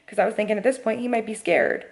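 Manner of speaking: usual speaking voice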